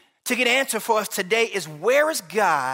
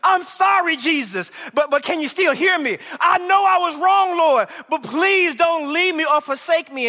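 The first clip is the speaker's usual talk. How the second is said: desperate plea; 6 semitones higher than usual. Retold or imitated. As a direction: beg the Lord not to leave. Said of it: "You're saying".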